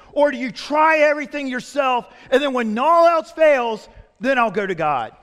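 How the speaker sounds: rising, loud pulpit build; preaching at full force. Acted. Preacher pressing his flock, full force. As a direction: drive the point home to the congregation.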